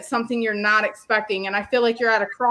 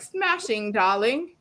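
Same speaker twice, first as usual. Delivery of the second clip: put-on British accent; slower than usual. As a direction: quote it in a playful British accent. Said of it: "I heard it in the accent".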